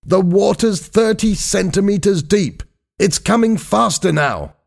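high-pitched, urgent report; impatient alarm. A narrator's reading. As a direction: press them with rising danger, voice high. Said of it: "said Doctor Blake impatiently".

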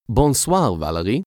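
a playful put-on French accent on the greeting; mock French accent. A narrator's reading. Boy teasing a girl he likes, in a light mock French accent. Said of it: "said in his best French accent".

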